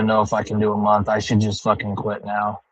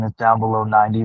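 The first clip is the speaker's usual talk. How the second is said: ordinary talk, nothing marked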